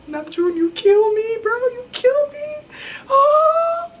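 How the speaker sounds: very high, drawn-out swooning squeal; swooning fangirl. Retold or imitated. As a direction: squeal it high, stretching the words. Acts out her swooning reaction.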